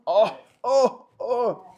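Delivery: normal talking voice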